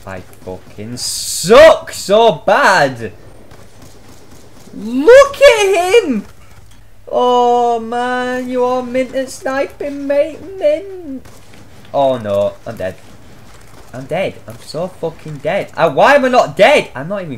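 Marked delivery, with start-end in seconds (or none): 4.7-11.3 high mock praise. Real, not acted. Gamer mock-praising enemy sniper.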